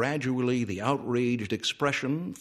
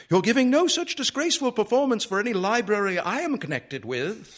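high, fast, loud indignant outburst; outraged matron forbidding it in one rush; furious high-pitched protest; angry; 12 semitones higher, 5 dB louder, faster than usual. A narrator's reading. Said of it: "said Missus Dillway angrily".

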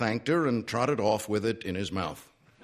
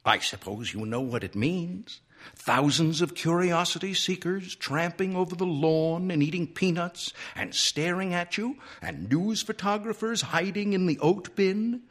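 pretty sore; 6 semitones higher than usual. A narrator's reading. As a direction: build the grievance higher with each item. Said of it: "he was pretty sore".

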